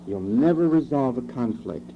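ordinary talk, nothing marked

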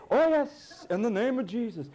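high, loud showy "Oh yes"; hammed-up pious exclamation; mock pious. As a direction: imitate a churchgoer's confident declaration. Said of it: "what you do is you say".

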